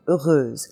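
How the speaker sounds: easy French heureuse; easier French heureuse. Shown as a demonstration. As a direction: model heureuse slowly, the easy way. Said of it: "start off with the easier one".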